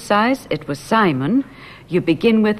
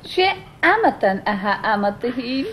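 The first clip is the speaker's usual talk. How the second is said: recorded model voice; 5 semitones higher than usual. Shown as a demonstration.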